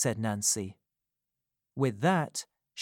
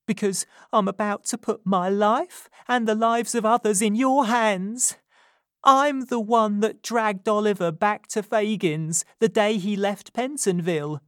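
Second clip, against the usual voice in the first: put-on higher, louder, urgent young woman; 9 semitones higher, 7 dB louder than usual. A narrator's reading. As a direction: confess it as if your life depends on it. Nancy confessing urgently.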